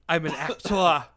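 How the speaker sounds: loud, grand actor cat; talking cat. Retold or imitated. Speaking for the cat on the show, loud hammed-up actor. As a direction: declaim it as a self-important cat. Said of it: "That's what she's saying".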